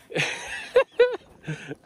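a burst of very high laughter; excited laugh mid-fight. Real, not acted. Laughing during fish fight.

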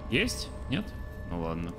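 ordinary delivery

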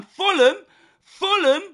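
loud, high hype shout; shouting Fulham. Real, not acted. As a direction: bellow the club name. Hyping himself up for the match.

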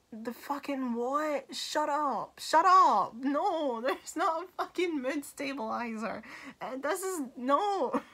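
high-pitched mock outrage; comic refusal to accept it; incredulous outburst. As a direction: blurt it in exaggerated disbelief, laughing midway. Acted outraged reply to the plot device.

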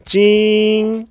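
one long, level whirring note; imitated window motor. Driver's window rolling down, imitated motor sound. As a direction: drag it out like an electric window.

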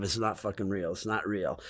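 low, soft self-reassurance; talking to oneself. Retold or imitated. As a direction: say it under your breath to steady yourself. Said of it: "I just said to myself".

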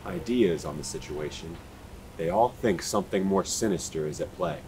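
calm, measured voiceover read; documentary narration. Acted. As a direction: read it like a true-crime intro. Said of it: "I'm gonna do a reshoot".

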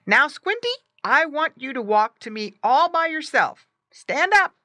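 put-on high, loud boy's voice; boy commanding. A narrator's reading. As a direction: coach a pet with loud, high orders. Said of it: "Bob said".

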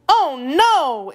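high, loud cry of sympathy; sorry exclamation. A narrator's reading. Dorothy pitying the miserable Wheeler, sorry exclamation. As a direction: exclaim it high and full of concern. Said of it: "exclaimed Dorothy, who was sorry".

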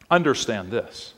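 ordinary talk

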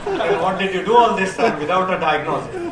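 incredulous laugh while asking. Real, not acted. Senior doctor laughing at resident with no diagnosis, laughing.

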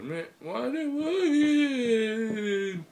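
very high, loud, drawn-out wail; exaggerated slow, squealed complaint; mock whine. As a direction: stretch it into a falsetto whine. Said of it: "Sorry, say it like that".

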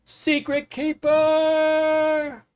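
long drawn-out call from afar; bellowing call. A narrator's reading. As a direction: call her name across the forest. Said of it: "a voice bellowed".

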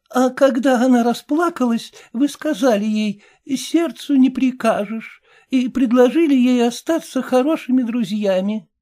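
put-on a stern old woman asking pointedly; a hint of a probing old dame; old lady. A narrator's reading. Old lady recounting his affair.

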